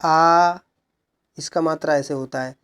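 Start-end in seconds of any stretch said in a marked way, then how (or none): 0.0-0.6 आ drawn out long; long vowel. Shown as a demonstration. Teacher listing long vowels, आ held long. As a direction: hold आ steadily for extra time. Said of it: "ह्रस्व से अधिक समय लगता है".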